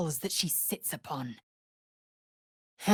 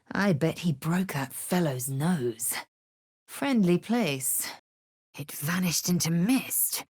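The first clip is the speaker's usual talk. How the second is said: normal talking voice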